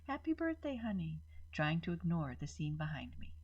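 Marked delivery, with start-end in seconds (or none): 0.1-1.2 higher, sweet birthday wish; mother soothing her daughter amid chaos; calm and smiling. A narrator's reading. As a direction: force a calm smile into it. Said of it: "trying to keep calm, smiling".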